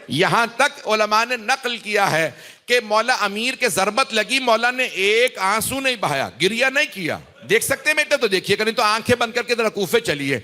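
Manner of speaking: high, sustained mournful chant; masaib recitation. Acted. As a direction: carry the mourners into grief with a lifted, keening voice. Masaib recital rising to lament. (بلند، درد بھرا مرثیہ خوانی کا انداز)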